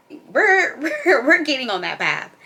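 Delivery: real laughter breaking through the words; laughing. Real, not acted. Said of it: "laughs while speaking".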